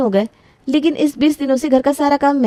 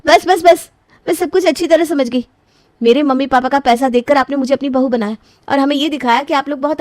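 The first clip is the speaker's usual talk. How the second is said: furious outburst; 3 semitones higher than usual. Acted. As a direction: scold them for the betrayal. Bride furious at deception.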